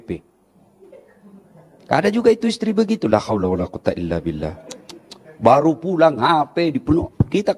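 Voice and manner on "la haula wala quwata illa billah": weary lament. Acted. Exasperated sigh at nagging wives.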